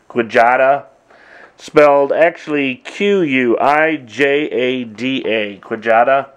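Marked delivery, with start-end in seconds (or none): 0.1-0.9 "Quijada" said as kwih-JAH-duh; old-style pronunciation. Shown as a demonstration. Drummer showing viewers the old name, "Quijada" as kwih-JAH-duh. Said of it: "the old way of saying it". 5.6-6.3 "Quijada" said as kwih-JAH-duh; old-style pronunciation. Shown as a demonstration. Drummer showing viewers the old name, "Quijada" as kwih-JAH-duh. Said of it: "the old way of saying it".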